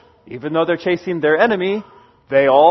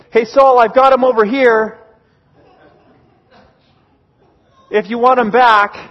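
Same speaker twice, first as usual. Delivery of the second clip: louder shout over a distance, acted in a retelling; calling across; 3 semitones higher, 4 dB louder than usual. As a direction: call it over to a far-off camp. David calls across to Saul.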